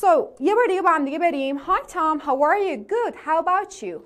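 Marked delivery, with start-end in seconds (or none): none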